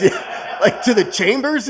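high, loud incredulous question; ex-offender stunned at a judge's invitation, excited and disbelieving; amazed disbelief. Retold or imitated. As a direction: blurt it out in delighted disbelief. Judge invites him to chambers.

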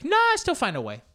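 high, loud protesting grandma; imitated feisty grandma. Grandma insisting to grandchild she still fights, high feisty imitation. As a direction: burst in as a combative abuela. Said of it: "So she was like".

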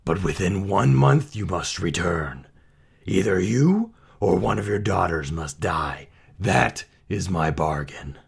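gruff, menacing ultimatum; growling creature, grimly commanding; beast's growl. A narrator's reading. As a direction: lay down a deadly bargain as a monster. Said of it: "the beast roared".

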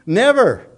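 a firm, final Never; emphatic never. Real, not acted. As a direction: land the word Never hard, alone. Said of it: "emphatic single-word answer".

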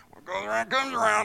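high, quick aside in a put-on voice; hurried, high-pitched gossipy mutter; behind-the-back gloating. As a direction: gloat about them behind their back. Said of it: "we usually say that behind somebody's back".

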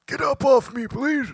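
squeaky pleading voice; high squeaky strained voice. Acted. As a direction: squeak it out like being crushed. Voicing squashed lungs.

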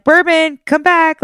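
high, calling after the dog. Retold or imitated. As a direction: call the runaway dog back, trying to stay cool. Calling dog back, trying cool.

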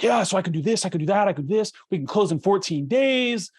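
high, pushy sales patter; eager sales pitch. Retold or imitated. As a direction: oversell it like a loan officer bragging. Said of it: "and he's like".

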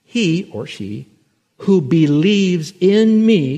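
slow it right down, leaning on every word; said slowly. Shown as a demonstration. Preacher reading the verse slowly to the gravesite crowd, drawn out. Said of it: "Let me say that slowly".

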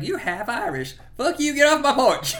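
high, loud sudden rage; Southern father turning furious, laughing through it; mock angry outburst. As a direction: snap at him to get off the porch. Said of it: "is like".

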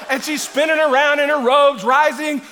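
high, loud, mounting delivery; excited preaching. Acted. Preacher picturing the Queen spinning, excitedly shouting. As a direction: keep the comic picture climbing. Preaches the comic scene at force.

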